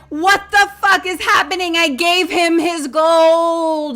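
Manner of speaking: high, loud, slow disbelief; frantic outrage. Acted. Jenny Ann yells at the chaos, outraged. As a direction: yell it in panic. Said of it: "Jenny Hand is like".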